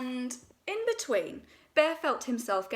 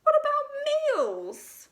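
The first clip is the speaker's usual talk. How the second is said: much higher, louder, slow wail; dismayed Pooh; 6 dB louder, much slower than usual. A narrator's reading.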